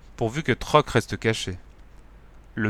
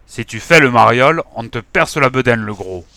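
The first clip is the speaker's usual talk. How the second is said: loud, menacing threat; nasty tone; 7 dB louder than usual. Acted. Thug threatening Ventru, loud and nasty. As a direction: growl the threat at the fat man. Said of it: "sur un ton mauvais".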